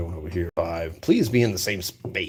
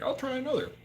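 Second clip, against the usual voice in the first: high mock voice; 4 dB quieter than usual. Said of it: "to be like".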